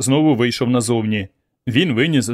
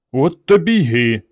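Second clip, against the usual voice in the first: gloating; 2 semitones higher, 4 dB louder than usual. A narrator's reading. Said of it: "насолоджувався уявною перемогою".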